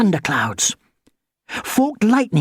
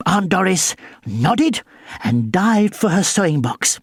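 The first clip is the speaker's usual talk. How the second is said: plain reading voice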